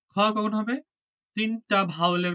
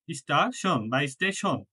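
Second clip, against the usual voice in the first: ordinary talk, nothing marked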